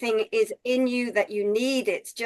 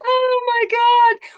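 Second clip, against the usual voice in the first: high, loud "oh my God"; high-pitched gasp of amazement; astonished; 12 semitones higher, 6 dB louder than usual. Real, not acted. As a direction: let the shock burst out. Astonished reaction.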